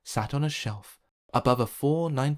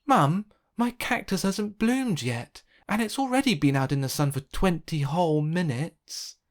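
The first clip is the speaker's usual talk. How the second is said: put-on impatient child; 6 semitones higher, 4 dB louder than usual. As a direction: complain to Mum like an impatient kid. Impatient child complains to mum.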